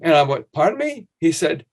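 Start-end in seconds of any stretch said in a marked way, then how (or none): 0.5-1.1 startled pardon me. Retold or imitated. Stunned reply to the dean.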